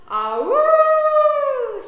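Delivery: long, high, very loud howl; dog howl. A narrator's reading. Dog howls as an ambulance whizzes past, howling long. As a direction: throw back a long, piercing howl. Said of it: "she howls again".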